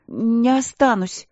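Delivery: high, slow and stubborn; teenage sister flatly refusing her queen sister, stubborn; stubborn refusal. Acted. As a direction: dig in and refuse, slowly. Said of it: "упрямо сообщила Алина".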